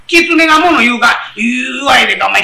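ordinary delivery

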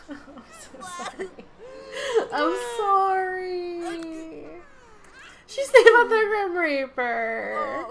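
slow, sob-laughing apologies; half laugh, half sob the apologies; mock sobbing. Vlogger apologising through laughter as the Sim grieves, mock sob.

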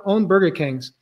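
ordinary delivery

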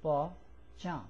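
ordinary talk, nothing marked

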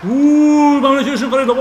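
high, loud whoop to the group; loud exclamation. Real, not acted. Player telling the others to look, exclaiming loudly. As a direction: cry out loudly for everyone to look.

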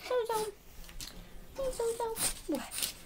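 high sing-song puppy talk; high cooing pet voice; baby talk to puppies. Acted. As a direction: coo at the dogs in a high voice.

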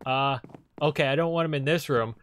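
ordinary delivery

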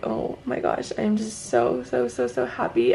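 breathy, tear-choked whisper of joy; overwhelmed with gratitude, barely getting it out; crying happy tears; teary happiness. Real, not acted. Tearful joy after eye surgery.

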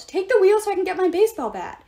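loud, high shouted order; yelling. A narrator's reading. Coach shouting for his baseball bat, loud. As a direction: shout it over the storm. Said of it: "Hedge yelled".

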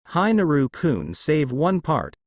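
plain reading voice

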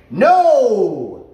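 the big verbal punisher; firm no. Shown as a demonstration. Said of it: "is my big one".

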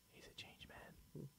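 slow hushed whisper. Pretend. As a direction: breathe it out as a secret. Mock solemn aside.

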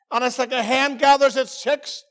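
ordinary delivery

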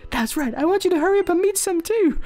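high, bubbly mother voice; cheery game mom. Acted. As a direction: pump the kid up as mom. Reads the game mother's dialogue.

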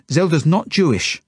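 high, loud insistence; urgent plea. A narrator's reading. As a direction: plead urgently to save a friend. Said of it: "I look at the woman pleadingly".

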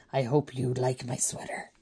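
soft unvoiced whisper. Real, not acted. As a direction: breathe it as a playful aside. Aside to viewers.

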